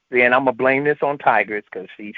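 ordinary delivery

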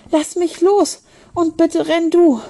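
slow, high, desperate plea; gasping. A narrator's reading. Charlotte begging Ben to leave her, gasping. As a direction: plead between gasps. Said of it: "japste sie".

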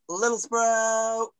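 slow, high announcement of the name; drawn-out announcement. Acted. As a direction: stretch the name like a showman.

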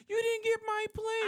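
very high, squeaky whiny complaint; mock her high whine.

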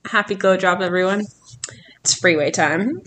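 subdued announcement. Pretend. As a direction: make the cheery greeting sound matter-of-fact. Same greeting redone in subdued way.

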